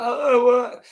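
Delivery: a high mocking outrage; mock outraged guy. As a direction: squeak it like a caught-out creep. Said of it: "they're like".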